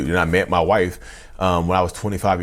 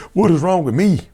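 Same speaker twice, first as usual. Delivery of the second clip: high-pitched frustrated outburst, retold; reenacted self-blame, pitch way up; exasperated; 12 semitones higher, 4 dB louder than usual. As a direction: throw the question out in exasperation. Retelling his frustrated self-questioning.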